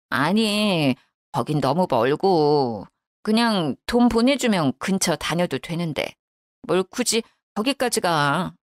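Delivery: put-on low, slow, grudging older woman; reluctant mother-in-law. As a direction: drag out the reluctant refusal. Mother-in-law declines the hospital.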